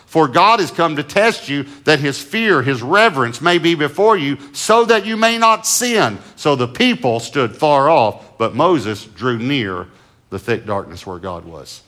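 loud, slow, lifted scripture reading; preaching scripture. Acted. Pastor reads Exodus to the church, ringing and slow. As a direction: proclaim the verse to the congregation.

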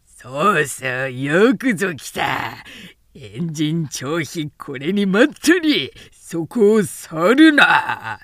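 slow, booming call from hiding; ambush commander calling out. A narrator's reading. Hidden enemy calls out loud, slow and menacing. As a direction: announce the trap with menace. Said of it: "中に声あって".

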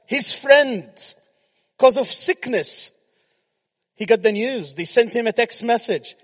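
high, loud sermon storytelling; preaching. Acted. Preacher telling the congregation the news about Lazarus, loud preaching.

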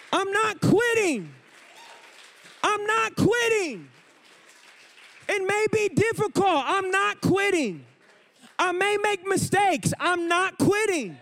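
fired-up preacher rallying the congregation; full-force preaching. Acted. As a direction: preach it to the rafters.

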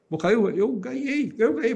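high, slow, overjoyed cry of a winner; crying with joy. Retold or imitated. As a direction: blurt out the big win, near tears. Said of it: "um senhor me abraçou chorando". (chorando de alegria, voz alta e lenta)

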